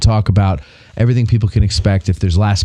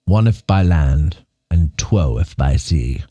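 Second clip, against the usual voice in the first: put-on British accent; 2 semitones lower than usual. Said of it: "Why is he British?".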